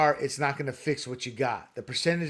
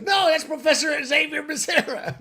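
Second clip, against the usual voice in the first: imitating a joking friend; 11 semitones higher than usual. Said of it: "he goes".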